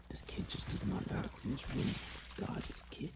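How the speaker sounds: hushed, mostly voiceless muttering; whispered grumble. Retold or imitated. Stepdad tidying a messy room, whispered grumble. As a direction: grumble under your breath, barely audible.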